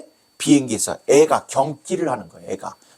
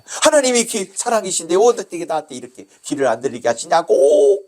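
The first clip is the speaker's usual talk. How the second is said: high, aggrieved outburst at God; acted cry of indignation; angry complaint; 6 semitones higher, 4 dB louder than usual. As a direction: protest bitterly, pitch raised.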